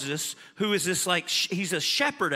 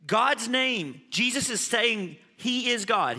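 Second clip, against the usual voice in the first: ordinary delivery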